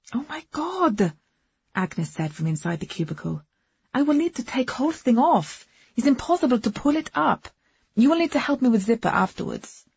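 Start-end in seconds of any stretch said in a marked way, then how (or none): 0.1-1.1 drawn-out high shocked cry; surprised exclamation. A narrator's reading. Agnes cries out from cubicle. 3.9-9.9 lightly voiced foreign woman; a hint of an Eastern European accent; Agnes voice. A narrator's reading.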